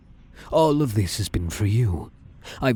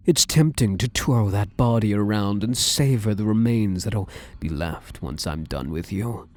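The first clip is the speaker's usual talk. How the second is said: ordinary delivery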